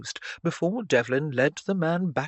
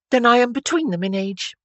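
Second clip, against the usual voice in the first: put-on higher, louder girlish voice; eager Stephanie; 8 semitones higher, 7 dB louder than usual. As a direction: pipe up with lively interest. Stephanie's excited dialogue, voiced by narrator.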